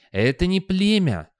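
high, drawn-out shout; calling after. A narrator's reading. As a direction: call it out slowly after someone leaving. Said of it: "крикнул он вслед".

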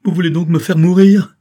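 high, very loud desperate cry; heart-rending cry. A narrator's reading. Daughter rushing to stop her father, anguished shout. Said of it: "Ce cri déchirant".

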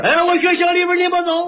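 high-pitched Shandong shopkeeper; imitated Shandong uncle; Shandong butcher. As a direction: ask the customer in thick Shandong dialect. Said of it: "张大爷他干吗".

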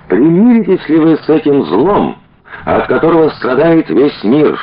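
high, ringing rhetorical question; political orator. A narrator's reading. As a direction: challenge a crowded hall. Orator addressing a crowded hall.

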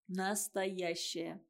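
НАСТОЯЩАЯ drawn out very slowly; emphatic repetition. A narrator's reading. Серёжа on true glory, "Настоящая" said slow and emphatic. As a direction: stretch "Настоящая" slowly to let it weigh.

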